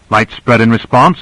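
ordinary delivery, nothing marked

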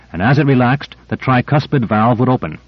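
ordinary delivery

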